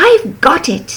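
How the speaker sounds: mildly thrilled eureka; excited cry. A narrator's reading. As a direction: snail struck by a clever plan, lightly excited. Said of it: "then cried".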